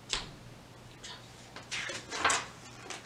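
breathy whispered chuff; soft footstep chuffs. Shown as a demonstration. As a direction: brush the sound out softly.

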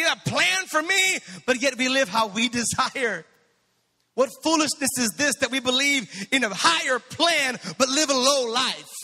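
rising sermon rebuke; preaching. Acted. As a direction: rebuke the congregation with fire.

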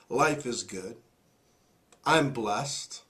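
low, soft, unhurried statement of gratitude; calm, grateful friend quoted softly; quiet contentment. Retold or imitated. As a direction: say it low and gently, as settled fact. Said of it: "the story that he says".

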